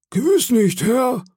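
very high-pitched, deferential voice; meek sailor. Acted. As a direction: answer obediently in a squeaky voice. Cowed sailor answers his questioner.

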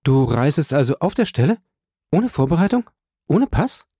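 immer höher steigende Fragen; ungläubig Frage um Frage nachsetzen; bestürztes Nachfragen. A narrator's reading. Julie bestürzt über plötzliche Abreise. (aufgeregte Schwester, Stimme zieht nach oben)

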